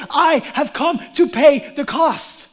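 forceful declaration. Acted. Jesus promising freedom to sinners, loud and bold. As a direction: proclaim the ransom boldly.

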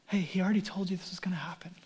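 softly whispered and quick; gentle whisper. Acted. Angel reassuring frightened disciples, fast soft whisper. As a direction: hush it like comforting someone scared.

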